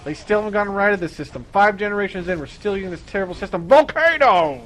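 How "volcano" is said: sudden shout. Real, not acted. Streamer yelling at a game event, sudden loud shout.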